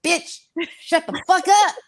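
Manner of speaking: high-pitched, playful outburst; mock telling-off. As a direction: shut down a nagging roommate with comic exasperation. Snapping back at inner roommate.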